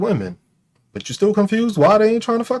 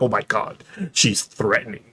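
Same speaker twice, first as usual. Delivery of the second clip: lower, softer mock whine of a scared man; 9 semitones lower, 5 dB quieter than usual. Imitating men who fear assertive women, low mock whine. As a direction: play the shocked guy clutching his pearls. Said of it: "you're gonna be like".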